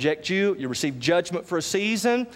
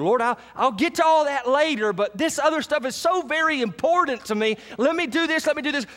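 a lightly voiced churchgoer, higher, begging for more time; pleading excuse; 11 semitones higher than usual. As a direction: whine it like someone stalling God. Congregant begging God to wait.

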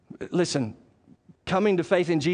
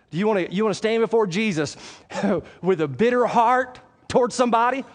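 ordinary talk, nothing marked

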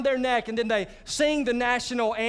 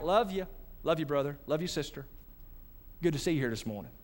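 offhand greetings; 7 semitones lower, 8 dB quieter, faster than usual. Retold or imitated. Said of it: "we can be like".

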